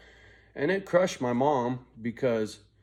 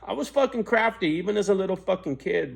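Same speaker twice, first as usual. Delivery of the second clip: laughing through the words, higher; 8 semitones higher, 5 dB louder than usual. Real, not acted. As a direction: let the laugh carry the boast. Laughing at own trickery.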